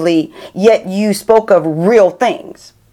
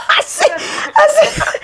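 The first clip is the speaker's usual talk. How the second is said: very high, laughing too hard to continue; helpless, slowed-down laughing words; cracking up; slower than usual. Real, not acted. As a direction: squeal it out through laughter. Cracking up at her own story.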